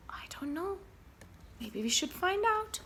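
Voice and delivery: soft unvoiced whisper; hushed storytime whisper. A narrator's reading. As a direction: lean in and whisper. Whispered aside to child.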